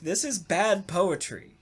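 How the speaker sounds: normal talking voice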